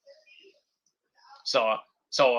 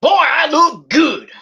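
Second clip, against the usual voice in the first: higher, louder, slow self-admiring line; proud mock boast; 9 semitones higher, 5 dB louder, slower than usual. Cameron admiring his butler look, higher, slow and louder. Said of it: "He says".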